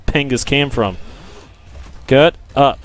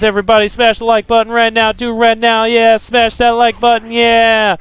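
hype call; 6 semitones higher than usual. Acted. Hyping viewers to like.

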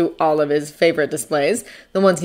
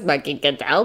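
usual speaking voice

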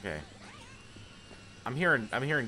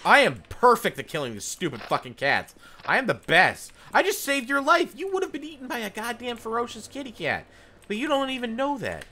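cocky boasting; 9 semitones higher, 4 dB louder than usual. Real, not acted. Boasting to game character.

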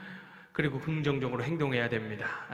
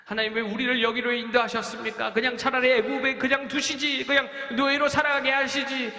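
higher, louder whining complaint to God; complaining Israelites; 9 semitones higher, 4 dB louder than usual. Acted. Israelites grumbling at God after the report, higher and louder. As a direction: wail it like a grumbling crowd. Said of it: "이런 말도 안 되는 황당한 이야기를 합니다".

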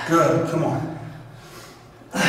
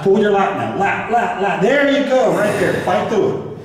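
urgent coaching; 5 semitones higher than usual. Real, not acted. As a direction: drive them through the last reps. Coach pushing lifter through reps.

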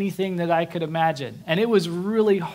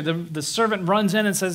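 usual speaking voice

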